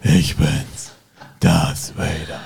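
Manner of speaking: low, slow Vader impression; Darth Vader voice. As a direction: drop low and play the Sith lord. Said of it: "mach mal den Darth Vader".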